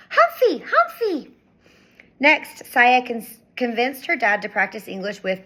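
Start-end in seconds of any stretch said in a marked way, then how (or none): 0.1-1.3 put-on high excited little-boy shout with a laugh; toddler shouting. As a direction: call out gleefully like a toddler.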